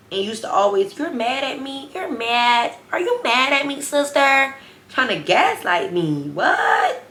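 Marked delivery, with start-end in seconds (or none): 1.0-4.5 high, sing-song whiny imitation of her; lightly hammed-up needy friend voice; mocking pleading friend. 6.3-7.0 sharp high rising disbelief; a stunned, outraged what; incredulous what. Real, not acted. Reacting to being gaslit.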